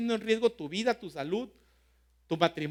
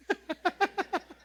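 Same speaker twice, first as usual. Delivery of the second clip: high laugh; 12 semitones higher than usual. Real, not acted. Laugh after joke.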